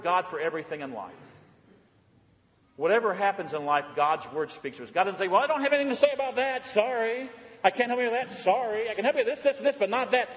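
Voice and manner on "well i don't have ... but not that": high-pitched, quick mock refusal; mock apologetic brush-off. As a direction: play an unhelpful helper begging off. Mimics a God who refuses help.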